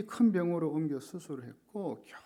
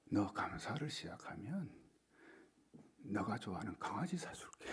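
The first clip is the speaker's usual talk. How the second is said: soft, lower whisper of a secret promise; 6 semitones lower, 8 dB quieter than usual. Retold or imitated. Father whispering a puppy promise to his daughter, hushed. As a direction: lean in and whisper the bribe. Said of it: "귓속말로".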